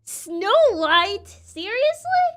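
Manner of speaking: loud, rising to very high; the witch can't believe it; outraged witch. Acted. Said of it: "witch voice is so good".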